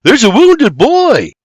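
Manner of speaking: high, loud, drawn-out cry; urgent shout. A narrator's reading. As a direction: cry out the alarming news. William shouts news to Doc.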